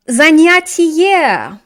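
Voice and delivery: занятие stretched out slowly, each syllable clear; teacher sounds out занятие slowly for class; slow model word. Shown as a demonstration. Teacher sounds out a new word for class, slowly.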